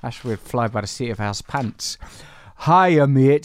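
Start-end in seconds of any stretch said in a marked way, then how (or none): 2.7-3.4 loud, higher-pitched Yorkshire voice; York accent. Acted. Co-host reads a listener's email in a put-on York accent. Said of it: "That's how people in York talk".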